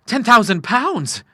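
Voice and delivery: high, loud, drawn-out amazement; astonished cry. A narrator's reading. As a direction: cry out slowly in disbelief. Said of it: "he cried".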